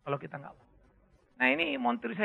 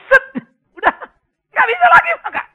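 much higher, loud shout; dramatic shout; 15 dB louder than usual. Acted. Preacher warning about diet pills, sudden loud shout. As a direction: shout it like the body is sealed shut.

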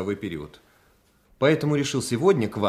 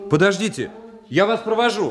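higher, urgent plea to wait; raised-pitch hurried offer; urgent calling; 8 semitones higher than usual. Acted. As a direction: call after someone who is leaving. Man calls after departing girl.

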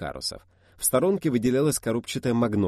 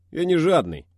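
playful protest; 9 semitones higher, 10 dB louder than usual. Acted. Protests smiling.

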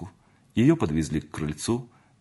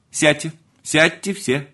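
loud, high, drawn-out order; slow, raised-voice command; 8 semitones higher, 5 dB louder, slower than usual. A narrator's reading. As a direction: order the whole room about. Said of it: "повелевал Антон Иванович".

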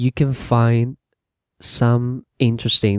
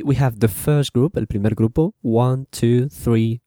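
ordinary delivery

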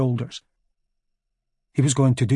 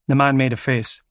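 ordinary delivery

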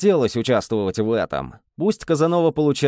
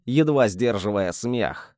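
plain reading voice

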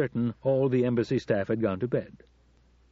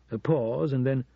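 plain reading voice

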